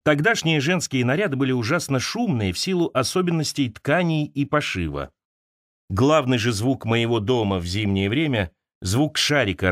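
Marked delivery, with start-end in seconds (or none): none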